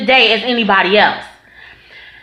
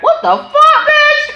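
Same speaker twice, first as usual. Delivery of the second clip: high mock outraged reply; slower than usual. Brother refusing the food, high mock outrage.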